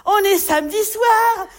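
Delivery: hammed-up cheerful hosts offering mocktails; thrilled hosts. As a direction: gush like proud teetotal hosts. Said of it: "ils m'ont dit tout contents".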